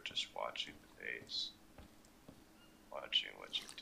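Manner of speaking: creepy lurker. Pretend. Creepy lurking primitive man act.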